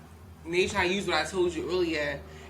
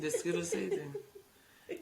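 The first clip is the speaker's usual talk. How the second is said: laughing slow; 4 dB quieter, much slower than usual. Real, not acted. Joking that candy calmed her.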